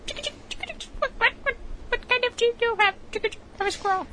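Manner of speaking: very high squeaky squirrel. Pretend.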